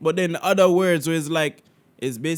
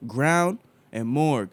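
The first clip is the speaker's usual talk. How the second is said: slow American-accent demo; American pronunciation, drawn out and deliberate; slower than usual. Shown as a demonstration. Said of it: "do it in my American accent".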